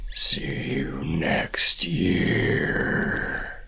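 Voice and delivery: low, very slow whispery demon; deep, drawn-out demonic rasp. A narrator's reading. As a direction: draw it out like a monster's threat. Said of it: "talk in a deep, demonic voice".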